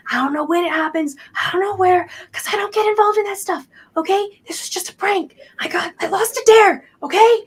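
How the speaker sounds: high, rising, flustered denial; defensive protest. Acted. Kid denies involvement, pleads prank.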